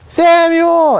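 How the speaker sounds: high, far-off call of Samuel's name. Acted. God calls the sleeping boy Samuel, high drawn-out call. God calling third time.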